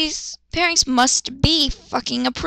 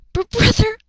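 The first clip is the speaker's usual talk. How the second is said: lightly voiced, higher girl's plea; girl pleading; 10 semitones higher, 4 dB louder than usual. Luna pleading with her brother, lightly voiced and high. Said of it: "Luna screamed at Kenta".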